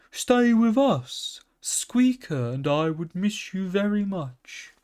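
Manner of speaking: high, slow, earnest plea; pleading calf. A narrator's reading. Calf begging the pony to stay, earnest. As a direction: hold him back with sincere pleading.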